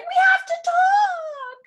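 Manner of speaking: high, loud, slow and teary; hammed-up tearful mom demanding a talk; stern mom. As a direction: wail it like a dramatic mother. Mom confronting son.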